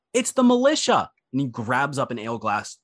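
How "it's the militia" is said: high, loud alarmed yell; crowd member yelling. Acted. A patron warning the crowd of the raid, yelling loud. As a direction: warn the whole room in a panic. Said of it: "someone in the crowd yell".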